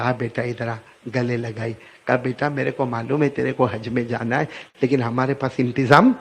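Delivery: soft, low consoling mother; tender mother. Acted. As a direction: hold him close and soothe. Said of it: "پھر ماں کو رحم آیا". (نرم، دھیمی آواز میں پیار بھری ماں)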